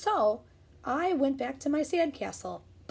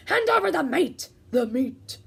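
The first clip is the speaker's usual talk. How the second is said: loud higher pirate demand; crew roar; 4 semitones higher, 6 dB louder than usual. Acted. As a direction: roar it greedily. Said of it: "we all roared".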